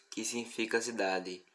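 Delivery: ordinary talk, nothing marked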